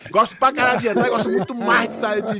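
playful brag, pushed up; animado. Real, not acted. Exagera em tom de brincadeira. (se gabando alto)

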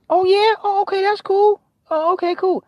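very high, forced-casual dad; fake-calm dad. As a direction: squeak out a forced "cool".

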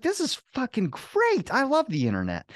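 high, slow, gushing mock joy; mock delight. Watching the repair video with his buddy, mock gushing delight. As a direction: gush over the video like a fanboy.

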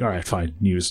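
low, soft, resigned grumble; bored resignation. Acted. Joke teller as driver settling for the news, bored. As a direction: give in with mild boredom. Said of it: "he's kinda bored".

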